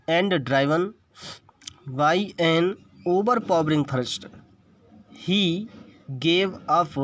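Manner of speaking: textbook read-aloud. Shown as a demonstration. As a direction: read in short, separated phrases for students.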